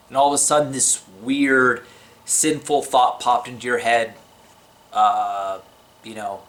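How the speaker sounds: usual speaking voice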